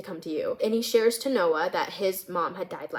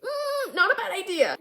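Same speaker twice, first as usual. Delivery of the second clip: pointed look voiced; 10 semitones higher than usual. Friend hinting she should see the counselor, pointed and high. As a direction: hum it high, then suggest it lightly. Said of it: "gives her this look that's like".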